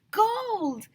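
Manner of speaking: high thrilled shout. A narrator's reading. Kid shouting to the others about gold. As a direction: cry out the discovery. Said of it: "shouted Neil the Nude Kid".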